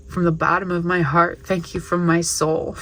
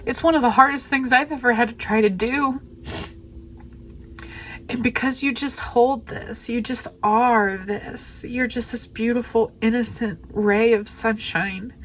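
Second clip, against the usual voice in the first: really choked up, higher-pitched voice; overwhelmed, tearful gratitude; 6 semitones higher than usual. Real, not acted. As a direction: speak through grateful tears, pitched high. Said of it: "just so overwhelmed and grateful".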